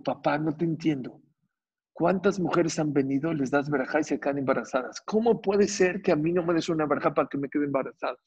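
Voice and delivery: ordinary delivery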